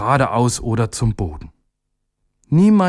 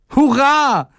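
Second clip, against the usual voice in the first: much higher, louder joyful cheer; 4 dB louder than usual. A narrator's reading. Little day cheering at the sunbeams, high and loud. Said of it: "rief der kleine Tag".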